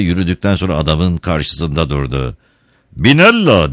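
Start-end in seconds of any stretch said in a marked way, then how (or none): 3.0-3.7 sharp, loud angry call of the name. Acted. Don Camillo confronting the referee, angrily. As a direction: snap his name in anger. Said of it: "dedi öfkeli öfkeli".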